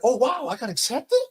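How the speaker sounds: overwhelmed, voice cracking high; stunned disbelief. Retold or imitated. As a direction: gasp it out, almost crying in disbelief. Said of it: "I was like".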